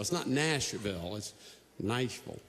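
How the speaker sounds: ordinary talk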